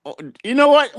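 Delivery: ordinary delivery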